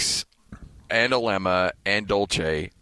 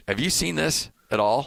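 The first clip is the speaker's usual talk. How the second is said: normal talking voice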